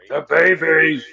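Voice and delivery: loud, high mock villain yell; hammed-up exclamation; yelled movie quote. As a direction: blurt it out as a big hammy shout.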